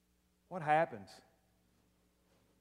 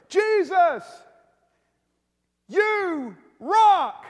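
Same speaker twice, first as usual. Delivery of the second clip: sudden high, loud scream; rock screaming; 13 semitones higher, 9 dB louder, much slower than usual. Pretend. Rock yelling praise at Jesus, high loud scream. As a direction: shout it out like an overeager rock. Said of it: "he just screams out of nowhere".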